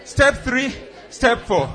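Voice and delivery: loud, high drill shouts; crisp loud soldier report. Shown as a demonstration. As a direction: bark each step like a sergeant. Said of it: "that chesty soldier voice".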